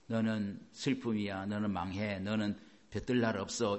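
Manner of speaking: lightly acted, hushed put-down; imitated discouragers. Voicing discouraging voices he heard.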